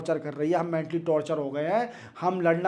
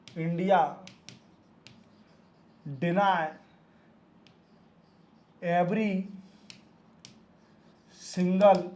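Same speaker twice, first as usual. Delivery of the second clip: each word spaced out slowly for copying; slow dictation; much slower than usual. Shown as a demonstration. Teacher to students taking notes, slow dictation.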